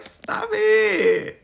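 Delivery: high-pitched mock stop-it; teasing mock objection; playful protest. Acted giggly protest to the teasing.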